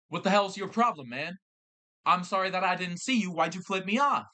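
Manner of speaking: angry. Retold or imitated. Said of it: "he goes".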